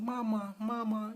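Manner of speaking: high, drawn-out toddler imitation; baby calling mama. Baby in dad's arms keeps asking for mother, high baby voice. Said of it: "she's just saying".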